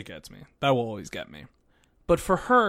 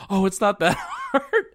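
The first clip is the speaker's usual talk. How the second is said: high, louder imitation breaking into laughter; laughing mock quote; 9 semitones higher, 5 dB louder than usual. Podcaster retelling Hikaru's reply to crying Misaki, laughing. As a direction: crack up while voicing his protest.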